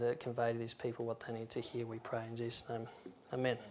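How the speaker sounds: soft, earnest prayer voice. Real, not acted. Preacher praying before the sermon, quiet and sincere. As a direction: pray it quietly to the Lord.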